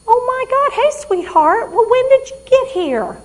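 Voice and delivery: put-on high, loud delighted greeting from an old mother; mother thrilled to see her daughter. Patient greets daughter as mom.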